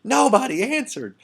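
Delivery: high, loud, wide-eyed whoa whoa; hammed-up amazed boy, laughing through it; young boy's amazed reaction. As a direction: play an astonished boy reading it.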